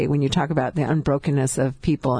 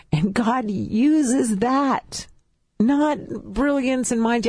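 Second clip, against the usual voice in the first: preaching; 3 semitones higher than usual. Acted. Guest testifying to radio listeners, in a preaching tone. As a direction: build it like a sermon to the faithful.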